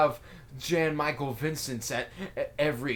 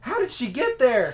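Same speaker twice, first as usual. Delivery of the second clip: high, louder, alarmed question as Morty; panicked Morty; 9 semitones higher, 4 dB louder than usual. Retold or imitated. Morty realizes the lady vanished, asks high and alarmed. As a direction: gasp it out like Morty has just figured it out. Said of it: "And then Morty, in realization".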